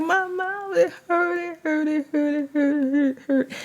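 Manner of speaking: high whimpering repeat of hurt; tearful high whimper, soft and repeated; crying. Retold or imitated. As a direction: whine it like a hurting child. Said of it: "I was like".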